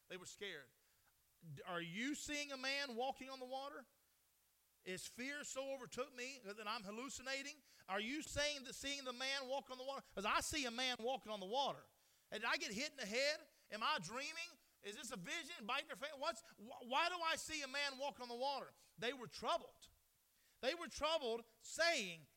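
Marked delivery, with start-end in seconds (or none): 1.6-18.7 loud, high, frantic questions; panicked disciple. Acted. Disciple seeing a man on water, shouting in panic. Preacher voicing frightened disciples. 19.0-22.3 loud, high, hammering preacher; fiery preaching. Acted. Preacher to congregation, full force.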